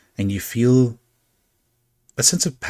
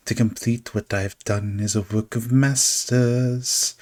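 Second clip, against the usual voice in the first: slow, wondering monologue to himself; dreamy showman musing; 2 semitones higher, much slower than usual. Acted. Carnival figure musing on his muse, slow and dreamy. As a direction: savour the masterpiece, slowly. Figure muses while walking off.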